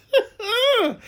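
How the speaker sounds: high-pitched real cackle; high peal of laughter; big laugh. Real, not acted. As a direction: burst out laughing at your own joke. Host laughs at own carpet joke.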